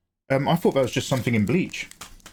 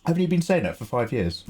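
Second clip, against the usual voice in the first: ordinary talk, nothing marked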